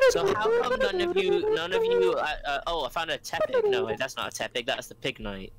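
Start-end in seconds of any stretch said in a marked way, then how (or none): 0.1-4.0 high silly voice. Pretend. High put-on voice.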